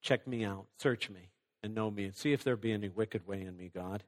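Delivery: soft, humble prayer, fading away; quiet prayer. Acted. Believer humbly asks God to search his heart, softly. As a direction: pray it softly, almost to yourself. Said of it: "you'll say".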